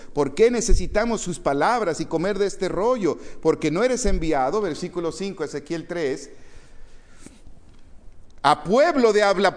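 loud, high preacher's reading; forceful scripture reading. Acted. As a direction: proclaim the verse to the whole room. Reads scripture at full force.

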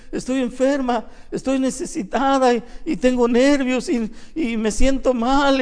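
high, loud, pleading complaint; troubled sister. Retold or imitated. Sick sister complains to her pastor, high and pleading. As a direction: plead your ailments to the pastor. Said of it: "Le dijo".

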